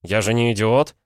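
слегка сердито, повыше; огрызнуться с умеренной досадой. A narrator's reading. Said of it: "сердито отозвался Пол". (сердито)

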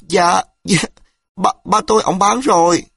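put-on girl confessing to the angry man in fear; frightened. A narrator's reading. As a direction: stutter it out, scared stiff. Terrified girl answers.

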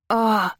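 short sharp cry of pain. A narrator's reading. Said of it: "не выдержала я".

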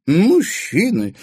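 high, loud, drawn-out disdain; scoffing at men; scornful snort. A narrator's reading. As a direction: snort it with contempt. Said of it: "фыркнула".

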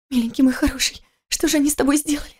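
высокий женский голос, лёгкое причитание; жена причитает над раненым мужем; причитание жены. Acted. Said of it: "причитала Кира сквозь всхлипы". (высоко, быстро, с намёком на всхлипы)

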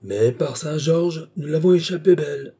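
shaken relief after a near miss; relieved exclamation. Acted.

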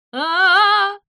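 long, high, loud "aaah"; falling scream. Acted. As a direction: scream all the way down. Zyza falls screaming.

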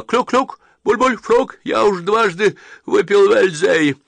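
high, loud, gurgling cries for help; drowning man shouting. Acted. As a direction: rant while going under the water.

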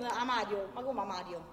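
usual speaking voice

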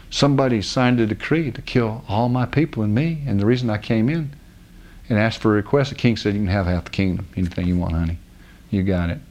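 low, soft retold appeal; subdued plea. Queen telling the king of the decree, subdued plea.

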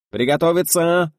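high, loud director's call with stretched vowels; drawn-out shout. A narrator's reading. Director calls the film crew to readiness, drawn-out shout. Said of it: "протяжно закричал Гайдай".

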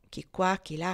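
plain reading voice

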